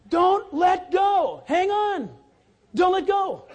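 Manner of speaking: loud, slow preaching shout on go; emphatic exhortation. Shown as a demonstration. Pastor driving the lesson home to the church, loud exhortation. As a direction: hammer home Don't let go. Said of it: "That's the word".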